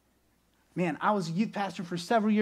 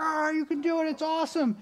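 higher, excited cheering; kids cheering; 11 semitones higher than usual. Retold or imitated. Kids in the crowd cheering for the chair holder, excited. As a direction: cheer a friend on. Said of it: "all the kids would be like".

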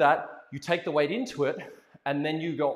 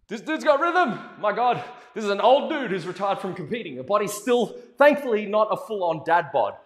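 excited teasing praise; 4 semitones higher, 6 dB louder than usual. Real, not acted.

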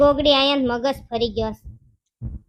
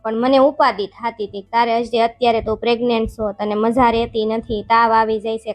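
lower, coaxing husband's voice; calm her down, lower and pleading; 3 semitones lower than usual. Acted. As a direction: lower husband voice begging his wife to stop crying. Husband soothing pregnant wife.

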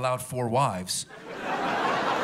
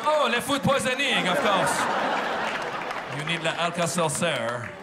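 put-on much higher, louder French-accented exclamation; mock French pharmacist; 4 semitones higher, 3 dB louder than usual. Pharmacist finally understanding the sick tourist, mock French accent. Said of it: "He goes".

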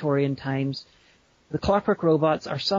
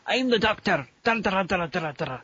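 hammed-up Scottish Doctor, pitched up; cartoonish Scottish; 4 semitones higher than usual. Podcaster retrying a mocked impersonation, cartoonish Scottish accent. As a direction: overdo the Scottish accent, then chant a theme tune. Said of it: "Your Scottish accent is very pronounced".

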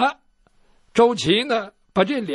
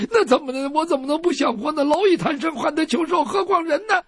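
high, quick cringing excuse; frightened pleading; 8 semitones higher than usual. Acted. Captive doctor insisting he wants to live, high cringing voice. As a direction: babble to save his skin.

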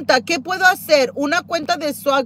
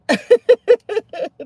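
high laugh; 9 semitones higher than usual. Real, not acted. Laughing at her own refusal.

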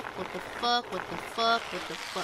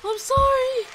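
high, loud desperate sorry; frantic apology; 9 semitones higher, 7 dB louder than usual. Real, not acted. Gamer failing to save a character, high desperate shouts. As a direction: cry out the apology as it all goes wrong.

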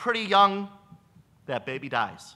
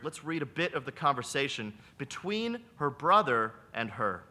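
ordinary talk

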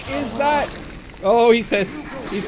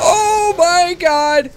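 slow frightened cry; 7 semitones higher, 8 dB louder, much slower than usual. Real, not acted. Scared by the monster.

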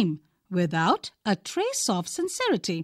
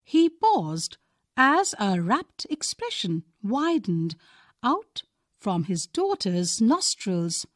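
ordinary delivery, nothing marked

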